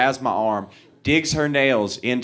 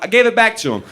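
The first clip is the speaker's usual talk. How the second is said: higher, a bit louder matter-of-fact answer; excited reply; 10 semitones higher, 4 dB louder, faster than usual. Retold or imitated. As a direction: blurt it out innocently. Own retold reply.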